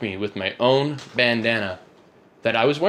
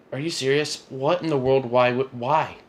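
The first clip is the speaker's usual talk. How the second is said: higher-pitched, mildly exasperated disbelief; incredulous reply; 3 semitones higher than usual. Retold or imitated. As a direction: retell your disbelief at a false accusation. Acted reply to the false accusation.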